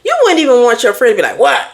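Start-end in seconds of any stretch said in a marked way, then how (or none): 1.4-1.7 rude what. Retold or imitated. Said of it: "that sound rude".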